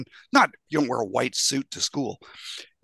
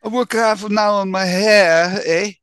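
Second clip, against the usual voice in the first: higher, louder, slow New York tough guy with a Canadian eh; New York Canadian accent; 7 semitones higher, 5 dB louder, slower than usual. Pretend. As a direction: put on a disco-era New York swagger. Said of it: "a New York accent with a Canadian accent combined".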